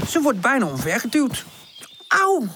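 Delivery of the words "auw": high, sharp "ow"; angry cry. A narrator's reading. Pip almost knocked over by her friends, angry. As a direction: yelp it high and cross. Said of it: "roept Pip boos".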